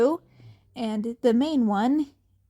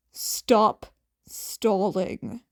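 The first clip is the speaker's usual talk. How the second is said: ordinary delivery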